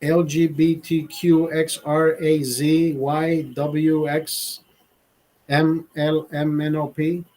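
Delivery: slow, drawn-out letter list; mock alphabet recital. Mocking the acronym as a slow alphabet list.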